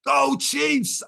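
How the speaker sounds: high shouted cheer; retold roar. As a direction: roar it at him like an MC. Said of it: "he just roars straight at me".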